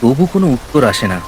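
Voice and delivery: ordinary delivery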